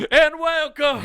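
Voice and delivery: high, loud put-on European accent; mock accented announcer. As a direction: welcome a crowd in a cartoon European accent. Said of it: "had no Italian accent there".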